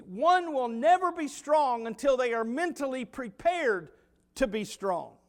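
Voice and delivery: ordinary talk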